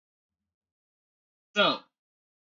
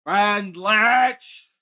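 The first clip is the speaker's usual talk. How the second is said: loud, raised-pitch roar of rage; enraged scream; 9 semitones higher, 10 dB louder than usual. Acted. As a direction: scream the name out in fury. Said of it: "screams out in rage".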